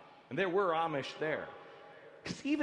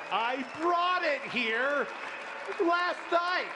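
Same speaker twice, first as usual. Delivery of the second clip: louder and higher, mock triumphant; proud reveal; 8 semitones higher, 4 dB louder than usual. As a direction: show off the smuggled butter, half joking. Shows contraband butter proudly.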